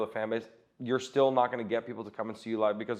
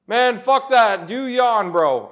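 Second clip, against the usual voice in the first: big, slow, much higher and louder put-on German accent; [name]'s German accent; 12 semitones higher, 11 dB louder, much slower than usual. Comic showing the buddy his German accent voice on stage. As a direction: ham up the German accent, stretching each word out. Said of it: "that accent on stage".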